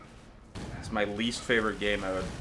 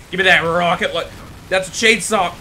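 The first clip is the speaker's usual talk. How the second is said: higher and louder excited call; excited shout; 7 semitones higher, 11 dB louder than usual. Real, not acted. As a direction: shout it out in surprise. Excited shouting at rocket launcher.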